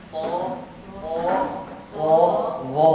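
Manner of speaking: full, thick "O, o, so, wo"; heavy back vowel. Shown as a demonstration. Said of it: "ini tebal, tebal".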